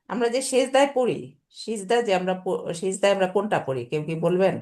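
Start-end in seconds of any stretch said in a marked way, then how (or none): none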